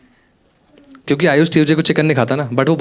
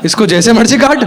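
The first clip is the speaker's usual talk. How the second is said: high, louder, laughing exasperation; exasperated outburst; 8 semitones higher, 5 dB louder than usual. Retold or imitated. Comic scolds his friend over cutting a banana, exasperated. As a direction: throw up your hands at the silly question.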